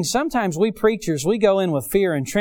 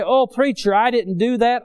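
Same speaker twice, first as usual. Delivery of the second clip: protesting denial; 5 semitones higher, 4 dB louder than usual. Retold or imitated. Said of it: "they'd throw their hands up".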